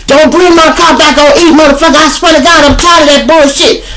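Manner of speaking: high, loud shrill-free mock nag; nagging girlfriend. Girlfriend scolding her man about the empty tank, high and loud. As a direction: screech the complaint at him.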